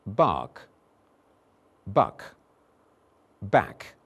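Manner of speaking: bark, buck, back said slowly and separately; minimal pair drill. Shown as a demonstration. Teacher drilling vowels for the class. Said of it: "And for the third time".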